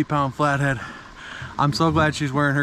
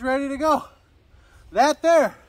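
excited; 9 semitones higher, 5 dB louder than usual. Real, not acted. Proud catch closer.

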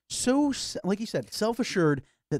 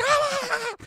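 very high shriek; mock scream; slower than usual. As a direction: squeal in fright.

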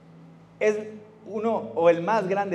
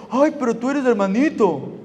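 mocking taunt; 7 semitones higher, 5 dB louder than usual. Mocking peers quoted.

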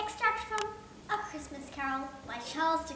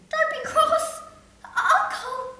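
much higher, louder coaxing nephew; pleading nephew; 13 dB louder, much slower than usual. Acted. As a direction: coax the uncle out of his mood.

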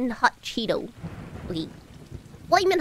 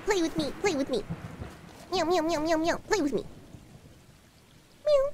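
pleading kitten; 5 dB quieter, faster than usual. Pretend. Voicing the cat.

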